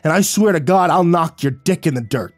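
loud, high threatening shout; panting threat. A narrator's reading. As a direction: bark it out, out of breath and furious. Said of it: "He panted, Shut your mouth".

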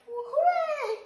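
high, loud happy cheer. Real, not acted. Cheering at the end.

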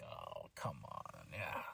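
very low, slow, disgusted groan of a voice; disgusted Roman. Retold or imitated. Retelling Roman's disgust on the couch, low and slow. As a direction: drag it out deep like a fed-up boss. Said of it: "Roman just has this disgusted look".

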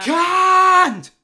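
Can't screamed very high and very loud; full scream. Acted. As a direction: blast Can't at the top of the lungs. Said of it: "You shouldn't scream at me".

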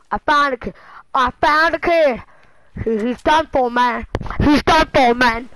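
excited, loud call on spotting prey. Real, not acted. Player to himself on spotting a target, excited. Spots an enemy in the game.

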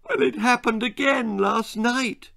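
high, crying wail. A narrator's reading. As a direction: wail it through tears. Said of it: "she wailed".

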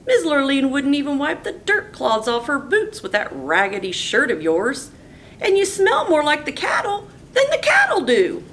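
very high, loud jeering; jeering brother. Acted. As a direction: pile on the insults. Brothers mocking Bubba.

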